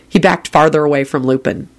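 ordinary delivery, nothing marked

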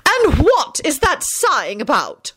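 high, slow, stern question; demanding. A narrator's reading. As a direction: demand an explanation. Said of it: "McGonagall demanded".

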